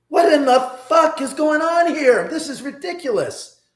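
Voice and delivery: high, loud disbelieving cry; incredulous outburst. Retold or imitated. Seeing Lindsay count loose bills, a loud incredulous outburst. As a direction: blurt out your shock.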